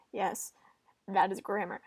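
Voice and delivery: ordinary talk, nothing marked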